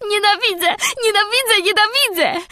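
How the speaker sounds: very high, loud crying shrieks; gagging. A narrator's reading. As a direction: stamp and sob out each word. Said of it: "krztusząc się i tupiąc stopami".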